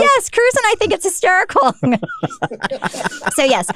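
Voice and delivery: high, giggly admission; real laughter on every word; laughing. Real, not acted. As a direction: laugh it out, delighted. Laughing about the joke.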